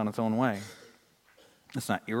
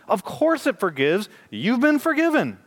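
higher, louder rising sermon voice; impassioned preaching; 9 semitones higher, 3 dB louder, slower than usual. Acted. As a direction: preach it with building fire. Preacher at full force.

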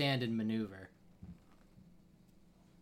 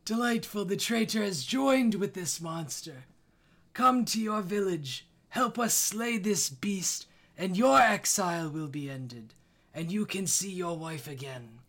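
a higher, louder scheming village elder; 7 semitones higher, 5 dB louder than usual. Acted. The Elder tempts the exiled hero with his wife, higher and louder. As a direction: taunt the traitor, then offer the deal.

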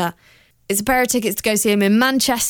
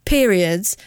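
ordinary talk

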